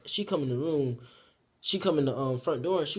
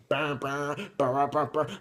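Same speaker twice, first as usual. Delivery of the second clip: mouthed car horn beeps; imitated car honking; slower than usual. Mama pulls up outside, imitated honking. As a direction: honk like a car horn in short bursts. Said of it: "my mama pull up honking and shit".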